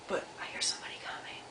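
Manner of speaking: hushed, wary whisper; secretive whisper. Acted. Mouse tells children somebody is coming, secretive whisper. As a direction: whisper it as if someone might overhear.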